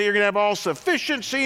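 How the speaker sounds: hammed-up televangelist promise; mock TV preacher. Mocking TV preachers for his congregation, hammed-up preacher voice. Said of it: "a teaching that essentially goes like this".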